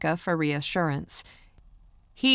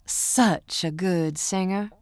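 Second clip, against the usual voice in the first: slow, drawn-out Southern gushing; playful Southern accent; 2 semitones higher, slower than usual. Acted. Pretend fan flattering the singer up close, Southern accent. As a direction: stretch it out in a country accent. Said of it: "I was being playful".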